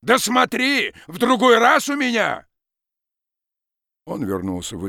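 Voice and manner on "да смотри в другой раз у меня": angry threat. Acted. Forester threatens after peasant.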